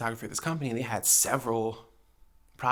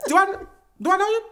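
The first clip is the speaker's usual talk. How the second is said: high suspicious retort; 9 dB louder, faster than usual. Retold or imitated. Retold retort to stranger.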